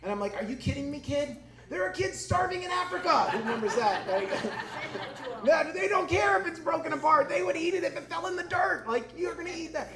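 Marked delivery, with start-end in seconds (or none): 0.4-3.2 high, mock-incredulous scolding, building louder; exasperated dad. Exasperated dad scolding his son. 5.4-9.9 high, loud mock rant; hammed-up scolding, voice pushed right up; exasperated dad. Exasperated dad scolding his son.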